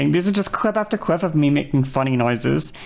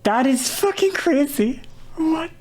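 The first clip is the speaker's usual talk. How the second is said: very high-pitched astonishment; incredulous; 10 semitones higher than usual. Real, not acted. Streamer reacting to their old voice recording, incredulous. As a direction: squeal it in stunned disbelief.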